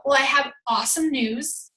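normal talking voice

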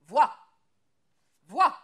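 very loud, high barked command; triumphant shout. Acted. Woodcutter shouting at wife to look at the mark. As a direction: force her to look.

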